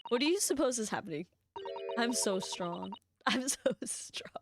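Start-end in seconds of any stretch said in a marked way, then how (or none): 3.3-4.3 slow breathy whisper through a laugh. Shown as a demonstration. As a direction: whisper it, giggling, slowed down. Repeats the line whispered.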